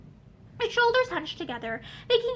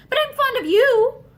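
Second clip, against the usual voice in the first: broken confession; 9 semitones higher, 8 dB louder than usual. A narrator's reading. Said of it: "said Charity brokenly".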